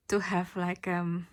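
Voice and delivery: pushing through a lump in the throat; emotional. Real, not acted. Tarot reader moved while describing unconditional love, choked up. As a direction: voice catching with sudden emotion. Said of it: "I don't know why I'm so emotional all of a sudden".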